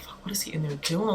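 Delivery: hushed, whispered aside; under-the-breath whisper; whispered disbelief. Acted. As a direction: whisper it to yourself, baffled. Muttered reaction while waiting outside.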